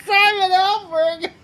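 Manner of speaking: real helpless laughter, voice squeaking high; barely getting words out through a laughing fit; crying with laughter. Real, not acted. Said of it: "I'm crying".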